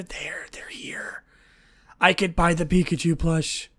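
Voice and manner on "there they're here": hushed, secretive whisper of relief; excited whisper. Real, not acted. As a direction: breathe it out as a happy secret. Finding plushies in stock.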